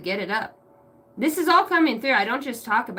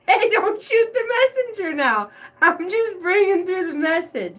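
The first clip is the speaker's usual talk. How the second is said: high, laughing through the words; giggling defensive protest; laughter cracking the voice; 6 semitones higher than usual. Real, not acted. Laughing hard.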